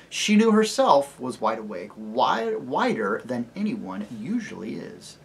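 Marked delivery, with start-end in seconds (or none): none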